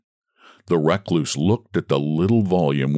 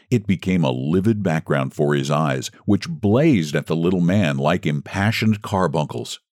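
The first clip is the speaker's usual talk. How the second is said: ordinary delivery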